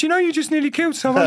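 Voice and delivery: mock-timid telling-off; high-pitched retold complaint. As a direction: voice pushed right up high.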